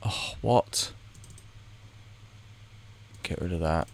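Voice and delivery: normal talking voice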